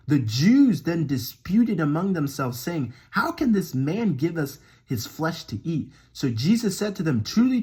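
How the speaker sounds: animated, emphatic Bible reading; scripture reading. Acted. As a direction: proclaim the verses, punching key words. Reads scripture aloud.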